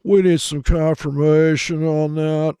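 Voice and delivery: a hint of a nervous, timid trader. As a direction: play a timid trader hedging, slowly. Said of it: "Is so timid".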